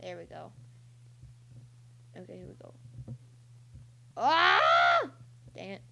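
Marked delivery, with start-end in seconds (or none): 4.2-5.0 loud high-pitched real scream. Real, not acted. Player failing at the game, scream. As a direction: scream out sharply, long and loud. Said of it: "I just screamed".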